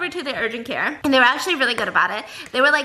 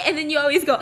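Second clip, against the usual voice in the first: ordinary talk, nothing marked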